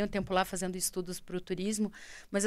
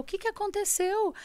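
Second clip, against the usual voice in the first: higher, worried father asking what happened; father's voice; 9 semitones higher than usual. Retold or imitated. Father asks his crying daughter what happened, higher voice. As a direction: imitate the father's worried question. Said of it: "meu pai falou".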